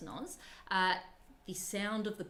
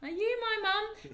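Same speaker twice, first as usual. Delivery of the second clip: penguin chick voice; 13 semitones higher, 4 dB louder than usual. Pretend. Voicing a chick on camera.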